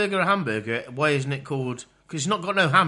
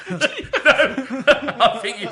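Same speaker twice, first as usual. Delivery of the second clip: giggling through the words; can't stop laughing; 9 semitones higher, 4 dB louder, much slower than usual. Real, not acted. Giggling fit on mic.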